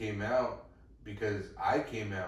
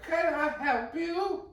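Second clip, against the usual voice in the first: put-on very high, slow, louder old lady voice; 7 dB louder, slower than usual. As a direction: peep it like a prim old lady. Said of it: "And she was like".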